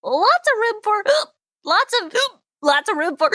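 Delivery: sentence restarted between hiccups. Acted. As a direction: keep getting cut off. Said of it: "when I get the hiccups".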